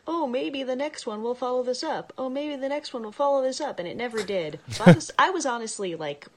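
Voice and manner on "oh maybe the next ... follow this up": hopeful fan voice. Retold or imitated. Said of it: "people were like".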